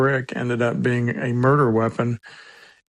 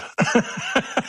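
chuckle; 11 semitones higher than usual. Real, not acted. Handler amused by his own otter joke, chuckling.

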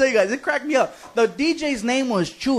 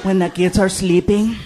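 flirty wife; 5 semitones lower than usual. Retold or imitated. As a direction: coax him like a wife at bedtime. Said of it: "And she tells him".

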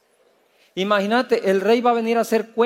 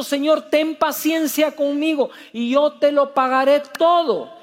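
raised-pitch mock plea; pleading; 6 semitones higher than usual. Servant begging his lord for patience, pleading. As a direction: plead earnestly, pitched up high. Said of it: "le suplicaba diciendo".

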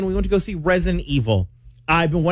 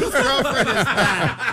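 slightly higher, slow imitation of a heckler; mocking moviegoer; 5 semitones higher, slower than usual. Moviegoer teases the man who screamed, mock wondering. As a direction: draw it out as a teasing onlooker. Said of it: "somebody was like".

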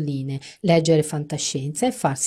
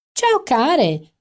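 much higher, louder trill; trilling greeting; 9 semitones higher, 7 dB louder than usual. Acted. Amanda trilling hello from across the bar. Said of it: "trillò dal fondo del bar".

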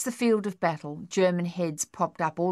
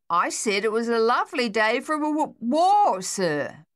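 louder repeat; 6 semitones higher, 5 dB louder than usual. Acted.

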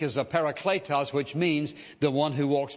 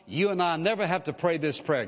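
ordinary talk, nothing marked

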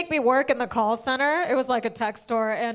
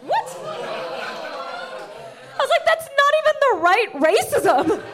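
incredulous; 10 semitones higher, much slower than usual. Retold or imitated. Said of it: "And I was like".

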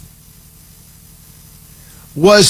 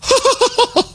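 high, falling mock laugh, a touch richer; retry the impression laugh, fuller; second try at Tucker's laugh. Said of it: "a little deeper, a little more rich".

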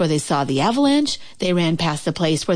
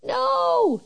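very high little voice; tiny peep. A narrator's reading. Shrinking Frog answering Toad, tiny high peep. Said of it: "peeped Frog".

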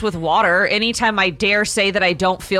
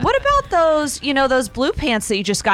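higher, sing-song helpful suggestion; mock sweet suggestion, lightly higher; sweetly suggesting wife; 6 semitones higher than usual. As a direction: coax him toward the blue pants, pitched up. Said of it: "I'll be like".

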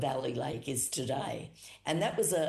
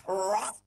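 loud imitated whoosh; 5 semitones higher, 5 dB louder than usual. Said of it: "The noise was tremendous".

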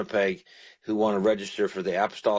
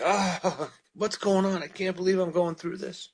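higher-pitched imitated groan of complaint; weary groan; 8 semitones higher than usual. Preacher voicing the psalmist's struggle, mock groaning lament. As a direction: groan it out like a worn-out sufferer. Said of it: "He groaned. He's like".

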